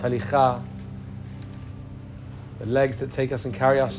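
ordinary delivery

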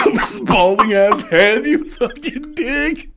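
high, strained words through laughter; losing it laughing. Real, not acted. Retelling the friend's photo prank, losing it laughing. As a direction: squeeze it out while cracking up.

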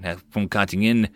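ordinary delivery, nothing marked

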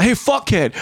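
high, cocky insult; mock rude Michael Scott. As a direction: blurt the insult like a sitcom boss. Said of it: "Michael Scott".

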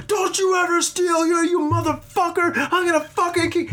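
very high, strained mock tirade; hammed-up yelling storekeeper. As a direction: play a furious shop clerk going way overboard. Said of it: "The guy was fucking yelling at me".

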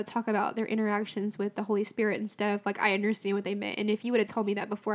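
ordinary talk

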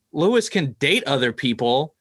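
usual speaking voice

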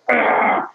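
imitated guttural groan; voiceless growl of pure frustration; frustrated growl. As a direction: groan out an exasperated "ugh". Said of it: "literally just went".

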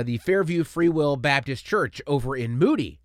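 usual speaking voice

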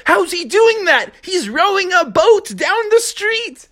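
high, baffled frog voice; high amazed voice. Acted. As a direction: disbelief at a rowing boat.

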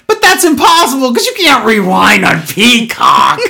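high, strained, hammed-up protest; mock outrage. Host ranting about streaming rewind, mock outrage. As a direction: escalate into absurd high-pitched indignation.

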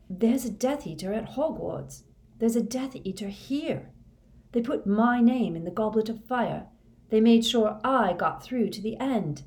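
high-pitched, urgent alarm; urgent warning. Acted. Harry warns Moody of a Death Eater, urgent.

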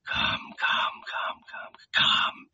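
quick, repeated voiceless whisper; barely audible whispered murmur; murmured luring. A narrator's reading. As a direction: whisper rapidly, coaxing the prey closer. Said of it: "he murmured".

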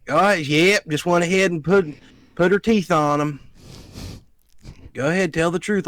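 hammed-up Southern tattling voice; fake Southern accent. Nephew mimicking aunt accusing the dog, fake Southern accent. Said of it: "puts on a fake accent".

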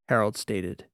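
usual speaking voice

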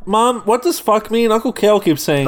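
high-pitched mock little kid; mock child. Young cousin to his mom, mock child voice. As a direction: play an innocent kid asking, pitched high.

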